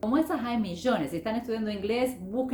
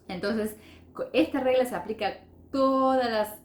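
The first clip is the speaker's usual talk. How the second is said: normal talking voice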